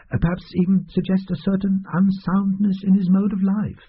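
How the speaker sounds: fussy little doctor. Acted. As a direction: play the oily doctor pretending it's hard. Doctor's voice held.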